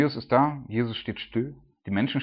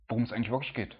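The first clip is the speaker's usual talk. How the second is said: ordinary talk, nothing marked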